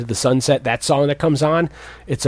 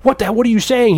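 high, louder indignant cry; hammed-up shock, pitched way up; mock outrage; 11 semitones higher, 5 dB louder than usual. Ali Sheedy's character outraged at Gutenberg, high mock indignation. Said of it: "And Ali Sheedy's like".

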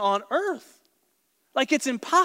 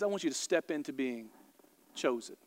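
God speaking softly; 7 semitones lower, 10 dB quieter than usual. Acted. Preacher voicing God softly.

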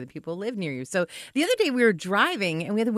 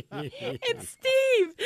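laughing; 4 dB quieter than usual. Real, not acted. Repeats neighbor's name laughing.

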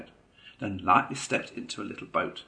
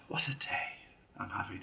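softer, breathed out in contentment; blissful sigh; 8 dB quieter than usual. A narrator's reading. Mole lying back in the boat, blissful. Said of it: "sheer happiness, blissfully".

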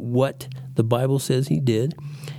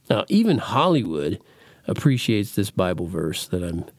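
ordinary talk, nothing marked

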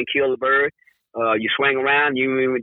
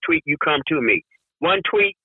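usual speaking voice

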